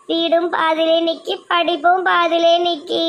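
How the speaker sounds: wailing complaint ending in sobs; hammed-up despair, slowing into a wail; tearful lament. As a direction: lament it, then break down crying. Despairing over house and studies.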